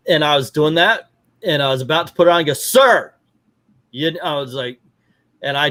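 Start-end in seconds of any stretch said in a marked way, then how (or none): 2.5-3.1 high, loud call to get his attention; sharp call. Retold or imitated. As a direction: bark it across the lot. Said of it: "yelling at somebody".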